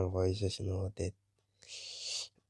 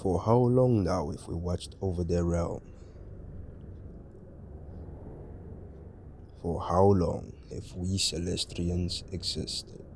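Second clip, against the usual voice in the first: solemn celestial narrator. Acted. Game narrator's solemn lines.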